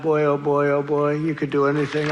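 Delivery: usual speaking voice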